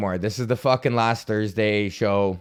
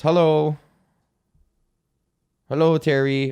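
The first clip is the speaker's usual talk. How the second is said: higher, louder hello to an interloper; calling a greeting; 4 semitones higher, 4 dB louder than usual. Real, not acted. Speaker greets someone who interrupts the show, calling out. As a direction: break off and call out hello.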